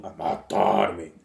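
slow, low voice from under the ground; cavernous voice. Acted. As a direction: speak as the earth itself, slow and deep. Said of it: "disse uma voz cavernosa".

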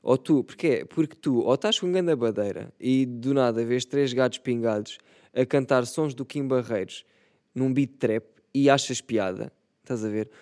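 usual speaking voice

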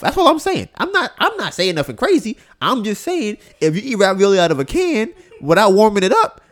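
high, loud incredulous rant; worked-up rant about a friend's odd eating; excited rant. Real, not acted. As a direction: pile it on, half laughing in disbelief.

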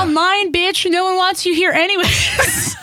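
high laughing mock scolding; giggly mock rant. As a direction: laugh while telling off an imagined customer. Mocking rude customer, laughing.

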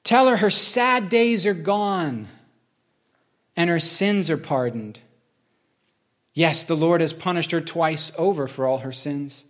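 slow, solemn reading of scripture. Acted. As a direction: lift the words up slowly, as if proclaiming comfort. Preacher reads Isaiah 40 aloud.

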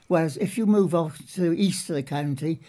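ordinary delivery

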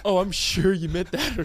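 ordinary talk

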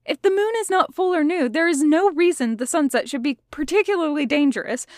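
high, hurried rush of words; talking quickly. A narrator's reading. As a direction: rattle it out fast and high, unconvinced. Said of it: "he was talking so quickly".

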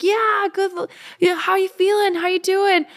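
very high imitation of friendly race chatter; excited runners' chatter. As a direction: chirp it like cheering strangers mid-race. Rapid chat with cheering runners.

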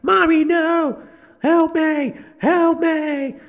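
put-on very high, pleading child's cry; screaming child. Kid held up by death, screaming for his mommy. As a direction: scream it like a terrified little kid. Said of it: "the kid is screaming".

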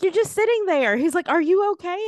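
high, loud, incredulous concern; imitated alarm. Man checking on her as she sits there, alarmed. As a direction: raise pitch and volume as the worried man.

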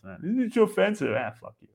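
normal talking voice

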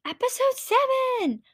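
high squealed title; excited announcement. Shown as a demonstration.

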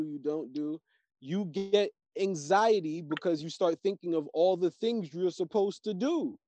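ordinary delivery

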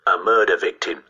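ordinary delivery, nothing marked

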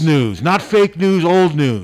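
loud, high mock-scornful dismissal; tongue-in-cheek, punched out loud and high; sarcastic. Real, not acted. Co-host to caller with stale schedule news, loudly sarcastic. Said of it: "somewhat sarcastically".

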